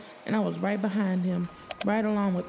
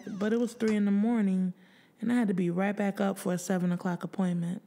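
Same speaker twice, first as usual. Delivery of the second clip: ordinary delivery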